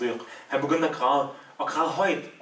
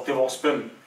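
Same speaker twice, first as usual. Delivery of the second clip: usual speaking voice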